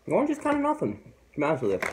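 talking between chews; snack in mouth; mouth full chewing. Real, not acted. Seen 0: Ate a straw at -5; lips pressed, jaw working through 1.8.

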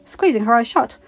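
ordinary delivery, nothing marked

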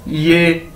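"ये" as one drawn syllable; careful teacher's "ये"; demonstrated pronunciation. Shown as a demonstration. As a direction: model "ये" for learners. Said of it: "कैसे pronounce करेंगे".

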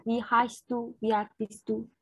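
ordinary talk, nothing marked

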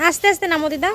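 loud, high instruction; to someone hard of hearing. Real, not acted. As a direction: raise voice so she hears. Helping grandmother down.

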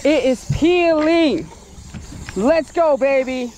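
ordinary delivery

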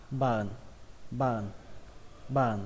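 "button" shortened to "ba-ờn", no t; dropped T. Shown as a demonstration. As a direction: run ba and ờn together for "button". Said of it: "bỏ luôn âm T ở đây". (đọc tắt "button" thành "ba-ờn")

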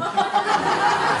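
short airy high laugh; teasing laugh; breathy laugh. Real, not acted. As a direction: laugh at your own tease. Host teasing about Potter.

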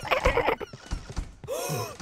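sharp frightened gasp; loud gasp. Real, not acted. Monsters chase her.

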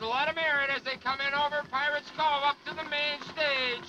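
booming showman announcer. Acted. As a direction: sell it to the crowd like a showman. Live water-ski show announcing.

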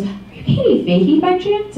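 a hint of a curious stranger recognizing her; imitating the donor. Stranger at a talk asks Katie if it's her, lightly imitated.

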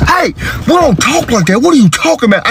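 high-pitched, exasperated dad; startled scolding. Acted. Dad scolding daughter's language, high exasperation. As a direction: check the child in a high protest.

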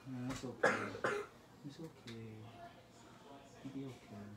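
soft, low reassurance; comforting brother soothing his sister softly. Real, not acted. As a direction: hush her gently.

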